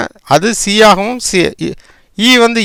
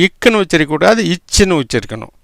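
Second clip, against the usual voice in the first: ordinary talk, nothing marked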